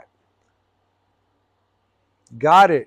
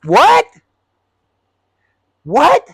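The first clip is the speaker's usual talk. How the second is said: much higher, louder incredulous what; stunned what; 10 semitones higher, 10 dB louder than usual. Retold or imitated. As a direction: explode in disbelief. Driver stunned by "this is left.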